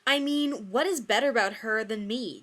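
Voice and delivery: high, loud hurt rant; loud jealous rant. Acted. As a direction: rant at him, wounded and loud. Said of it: "Joey continued to rant".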